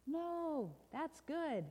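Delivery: high, lilting contradiction; cheery correction. Acted. Storyteller overturns bad news, high and reassuring. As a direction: sing out the good news.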